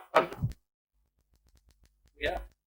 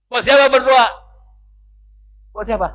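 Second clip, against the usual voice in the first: higher and louder, pressing; loud rhetorical challenge; challenging question; 7 semitones higher, 13 dB louder than usual. Acted. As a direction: fire the question at them.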